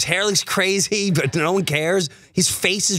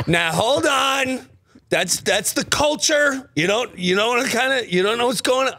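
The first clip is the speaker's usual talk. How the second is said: defensive fan; 6 semitones higher than usual. Retold or imitated. Defending player to wife.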